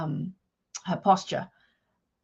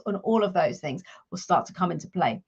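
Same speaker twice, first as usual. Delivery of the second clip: normal talking voice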